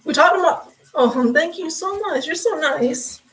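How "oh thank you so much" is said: ordinary talk, nothing marked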